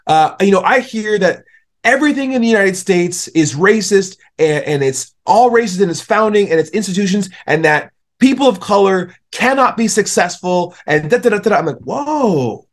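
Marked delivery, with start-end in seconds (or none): 0.4-11.6 retold angry rant. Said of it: "he was still really angry". 11.8-12.7 high, drawn-out whoa of realization; surprised whoa. Retold or imitated. Reacting to his explanation.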